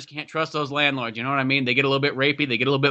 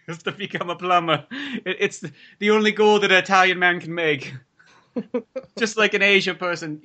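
higher, put-on landlord voice; lecturing papa; 4 semitones higher than usual. Landlord explains career options to his boy, mock earnest. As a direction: hand it down as mock life advice, amused.

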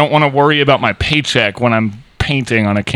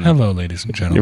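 lower, quick announcer voice; warm announcer; 4 semitones lower, faster than usual. Pretend. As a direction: greet the crowd like an emcee. Announcer greeting after DJ voice.

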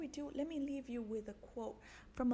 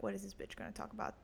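a low, quieter sneer of a puzzled ex; mock ex; 12 semitones lower, 7 dB quieter, faster than usual. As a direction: play the ex scoffing, lightly. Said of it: "they're just probably like".

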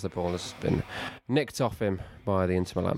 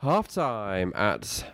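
very slow drawn-out half-time call; slow announcement; much slower than usual. Acted. Commentator announces half-time, very slow drawn-out call. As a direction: stretch it like a stadium announcer.

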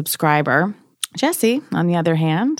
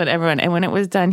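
ordinary talk

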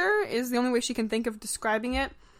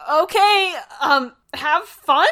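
bewildered; 5 semitones higher, 8 dB louder, slower than usual. Acted. Bewildered homeowner voiced.

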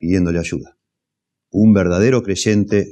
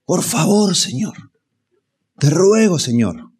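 pleading cry to God; 7 semitones higher, slower than usual. Pretend. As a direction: cry out to the Lord as if shouting in prayer. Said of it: "como si estuviéramos gritando".

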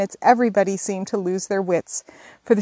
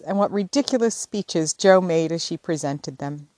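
plain reading voice

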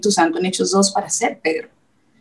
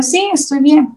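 fake fine; 8 semitones higher, slower than usual. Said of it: "Y yo".

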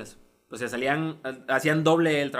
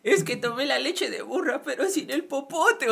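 mocking Leomon impression. Mocking the Leomon dub line to a friend, high and laughing. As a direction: squeak the cartoon line out in a mock high voice, giggling. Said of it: "cuando le dice".

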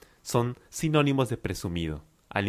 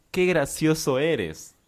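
higher, drawn-out mild sarcasm; sarcastic; 6 semitones higher, slower than usual. Acted. Annoyed friend thinks she is being teased, lightly sarcastic. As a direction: stretch it out with a touch of sarcastic annoyance. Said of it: "con una expresión peculiar".

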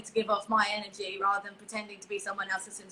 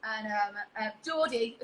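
ordinary talk, nothing marked

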